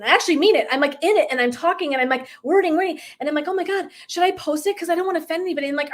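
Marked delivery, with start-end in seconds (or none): 3.5-5.7 higher-pitched retold worry; mock fretting. Coach fretting over posting her video, mock worry. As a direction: fret it aloud, high and hesitant.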